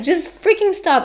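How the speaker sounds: high, loud retold outburst; exasperated protest. Telling mom to stop screaming at the kid, exasperated. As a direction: cut in loudly, pitched right up.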